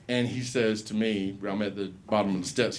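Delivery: ordinary talk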